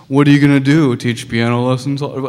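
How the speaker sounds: loud, dismissive challenge; mocking imitation of a dismissive friend; disrespectful friend. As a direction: scoff at the idea loudly. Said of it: "so kind of disrespectful".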